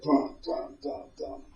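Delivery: imitated stomping. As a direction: mimic heavy steps in soft, spaced thuds. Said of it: "he's off stomping up the stairs".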